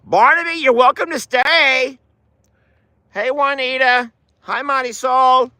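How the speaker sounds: high, friendly greeting call; calling to animals. Real, not acted. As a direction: sing out a welcome up high.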